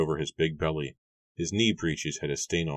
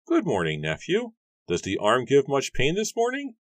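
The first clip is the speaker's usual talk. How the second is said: much higher, put-on voice for the farmer; gruff farmer Matthew; 10 semitones higher, 4 dB louder than usual. A narrator's reading. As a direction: play the grumpy farmer, pitched way up. Matthew greets his nephew.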